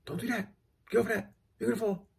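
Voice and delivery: put-on warning a child. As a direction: call out a warning to your child. Parent warning child on trampoline.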